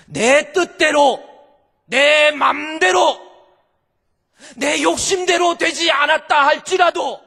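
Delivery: preaching shout. Acted. Preacher crying out to the congregation, shouting. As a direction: hammer each phrase out to the congregation.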